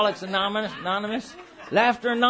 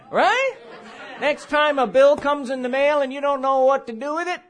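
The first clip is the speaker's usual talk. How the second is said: ordinary talk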